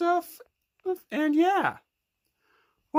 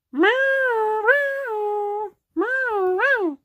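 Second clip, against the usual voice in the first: higher mock cat meows; snail meowing; 8 semitones higher, much slower than usual. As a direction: meow like a pet. Kid makes Gary meow.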